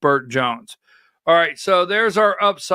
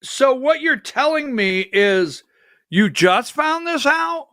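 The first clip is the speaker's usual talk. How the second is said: incredulous mock question, pitched way up; mocking disbelief; 7 semitones higher than usual. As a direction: sneer it like you caught him lying. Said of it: "And it's like".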